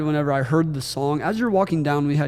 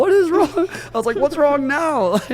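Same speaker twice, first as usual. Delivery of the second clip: high, loud exasperated questioning, re-enacted; hammed-up worried cry; mock alarm; 11 semitones higher, 5 dB louder than usual. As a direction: act out dismay, drawing the last question out. Acts out reaction to partner crying.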